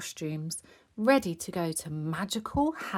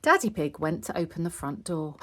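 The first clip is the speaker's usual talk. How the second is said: usual speaking voice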